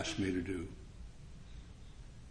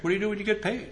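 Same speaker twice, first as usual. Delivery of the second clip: puzzled; 9 semitones higher, faster than usual. Retold or imitated. Retelling his confusion.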